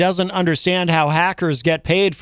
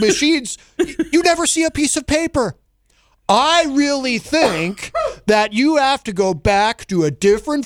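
Trump impression; 4 semitones higher than usual. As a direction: do a self-conscious Trump impression. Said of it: "my Trump impression".

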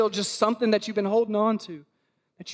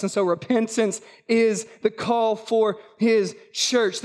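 ordinary delivery

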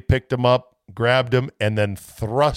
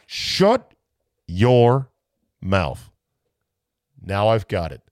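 normal talking voice

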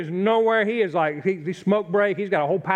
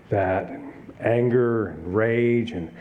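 slow, weighted; 5 semitones lower, much slower than usual. Acted. Sermon listing sins.

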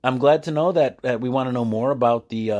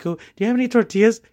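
higher, quick, wide-eyed fourth grader asking; innocent kid's question; 11 semitones higher, 4 dB louder, faster than usual. Retold or imitated. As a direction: ask it fast and high like a curious kid. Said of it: "asking Miss Shanku".

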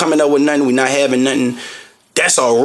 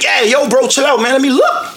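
going off; 10 semitones higher than usual. Retold or imitated. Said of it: "I be going off on the Arabs".

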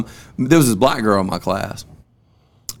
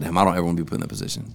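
hushed inner thought; 6 semitones lower, 7 dB quieter, much faster than usual. Retold or imitated. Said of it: "And I was like".

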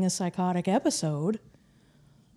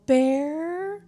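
calling out; 8 semitones higher than usual. Retold or imitated. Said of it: "And I'm like".

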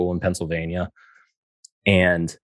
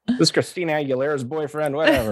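dismissive; 9 semitones higher than usual. Retold or imitated. Mocking interviewer's dismissive voice.

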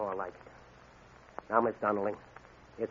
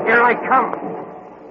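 feral wasteland raider; 8 semitones higher, 4 dB louder, much slower than usual. Acted. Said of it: "guess: feral wasteland raider".

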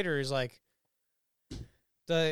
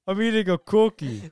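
put-on childish voice; 5 dB louder, slower than usual. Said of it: "What are you, five years old?".

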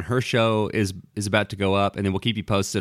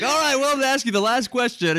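very high, fast chirpy host voice; co-host imitating his cheery wrap-up, high and fast; mock cheerful host; 13 semitones higher, faster than usual. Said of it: "I'm like".

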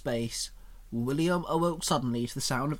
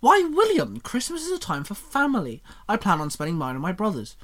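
much higher, huffy and offended; slightly offended; 6 semitones higher, 5 dB louder than usual. Acted. Xenon answering William's sneer, slightly offended. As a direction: take mild offence, pitched right up. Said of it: "slightly offended tone to her voice".

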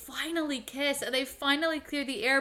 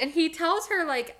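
ordinary talk, nothing marked

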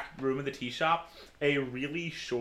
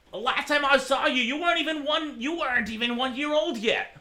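put-on higher, doting old man; 11 semitones higher, 5 dB louder than usual. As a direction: reminisce like a fond old man.

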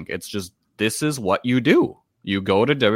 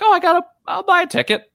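mock eager coworker voice, much higher and quick; 4 dB louder, faster than usual. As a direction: blurt it out like a fan who can't resist. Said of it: "They're like".

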